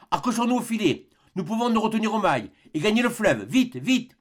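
high-pitched, pressing exclamation; urgent command. A narrator's reading. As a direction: urge them to hurry for their lives. Doctor urging escape from attackers.

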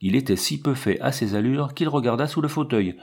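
ordinary delivery, nothing marked

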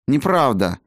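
high, sharp dismissive word; curt retort. A narrator's reading. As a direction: toss it back flatly. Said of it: "бросила Райна".

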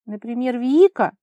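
loud, high jealous squeal; shriek. A narrator's reading. Vara advancing on Kolya over Vika, shrieking. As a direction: screech the rival's name. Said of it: "взвизгнула Вара".

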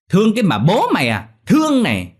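high, loud scornful outburst; furious shout. Acted. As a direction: shout it as the slap lands. Moneylender slaps the begging woman.

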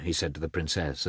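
ordinary delivery, nothing marked

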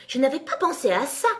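high, loud realisation; surprised exclamation. A narrator's reading. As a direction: exclaim it as a sudden discovery. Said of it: "s'exclama-t-elle". (exclamation surprise, voix haute)

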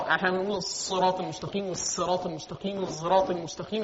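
الصراط المستقيم recited three times, each with a different sibilant sound as in the readings; variant readings. Shown as a demonstration. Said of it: "ليها كذا قراءة". (تلاوة الصراط بتلات قراءات بصوت واطي)

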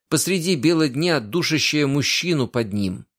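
ordinary delivery

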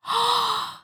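sharp high gasp of surprise. Acted. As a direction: catch your breath in amazement. Jane finally believes.

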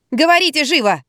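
high, impatient command; barked order. A narrator's reading. Wife to an unwelcome caller, angrily barking. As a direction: order the caller to talk, temper barely held. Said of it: "рявкнула я, с трудом сдерживая гнев".

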